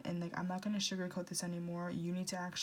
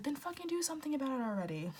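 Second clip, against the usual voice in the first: exasperated snap; 7 semitones higher, faster than usual. Retold or imitated. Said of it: "their friend is just like".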